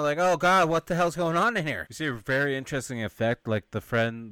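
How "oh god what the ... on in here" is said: higher, louder panicked cry; mock panic, higher and louder; freaking out. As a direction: act the crowd seeing a man hanging from the ceiling. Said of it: "everyone starts freaking out".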